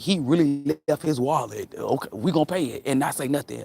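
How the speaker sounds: usual speaking voice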